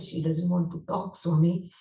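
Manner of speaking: usual speaking voice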